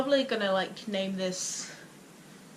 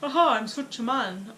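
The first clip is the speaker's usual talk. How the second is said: mock manly voice. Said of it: "when I sing, I'm like".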